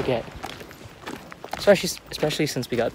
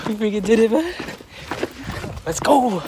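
higher and louder triumphant cheer; excited cheer; 9 semitones higher, 4 dB louder than usual. Real, not acted. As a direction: shout the win with joy. Loud repeat after laughing.

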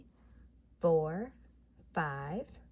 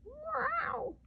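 meow; 3 dB quieter than usual. Acted. Cat noise in counting song.

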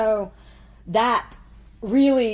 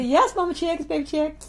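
put-on baby Chick reply; 6 semitones higher than usual. Answering mom's phone greeting.

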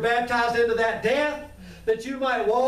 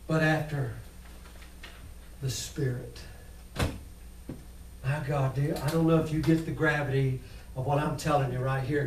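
much softer and lower, almost murmured; hushed awe; 10 semitones lower, 7 dB quieter than usual. Real, not acted. Preacher hushing the church over the gravity of grace. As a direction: lean in and hush it.

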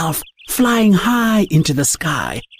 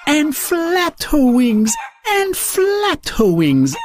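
rising higher and slower each time; building excitement; 9 semitones higher, slower than usual. A narrator's reading. Narrator builds suspense as Daisy climbs, excited. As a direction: lift the voice with each flap.

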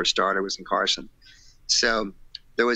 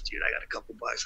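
quick, much softer whisper; 9 dB quieter, faster than usual. Retold or imitated. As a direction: breathe it out quietly and fast. Friend whispering about money.

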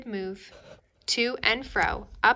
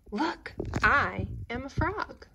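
triumphant; 4 semitones higher than usual. A narrator's reading. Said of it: "he said triumphantly".

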